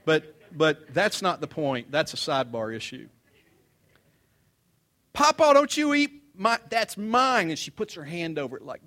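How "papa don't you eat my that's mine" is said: put-on much higher, loud child's protest; little girl claiming her cookie out loud; granddaughter shouting. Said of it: "comes Cadence".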